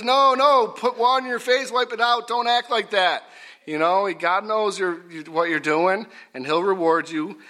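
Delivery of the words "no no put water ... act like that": high, insistent telling-off; imitated scolding. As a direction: cut them off sharply, pitched high throughout. Voices Jesus scolding gloomy fasters.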